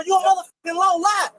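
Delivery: shouting back at the police; angry shout. Real, not acted. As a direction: yell it at the officer. Arguing with police at traffic stop.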